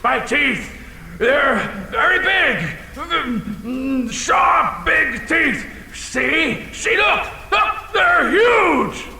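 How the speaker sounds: high, eager showing off; bragging about teeth. Acted. Dragon proudly displaying his huge teeth, high eager boasting. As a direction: show off like a proud kid.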